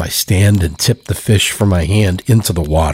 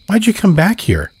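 put-on high, small child's voice, a little louder; young boy's voice; 13 semitones higher, 4 dB louder than usual. A narrator's reading. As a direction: voice the drowned little brother, pitched high. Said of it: "Brett asks".

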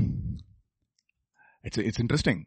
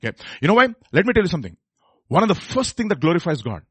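ordinary talk

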